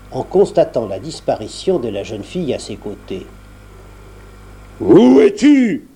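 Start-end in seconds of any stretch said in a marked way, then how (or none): 4.8-5.9 booming ogre roar, high and slow; thundering shout. A narrator's reading. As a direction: bellow it like a furious ogre. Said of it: "cria-t-il d'une voix de tonnerre".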